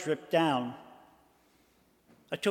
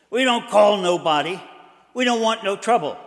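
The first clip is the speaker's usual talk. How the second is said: gruff druggist; 2 semitones higher, 8 dB louder than usual. Acted. Druggist voiced in story.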